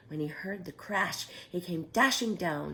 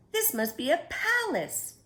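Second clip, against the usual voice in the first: high, louder breathless wonder; gasped in awe; 12 semitones higher, 6 dB louder than usual. A narrator's reading. Corduroy gasping at the furniture floor, higher and louder with awe. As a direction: gasp it out, amazed at the sight. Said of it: "Corduroy gasped".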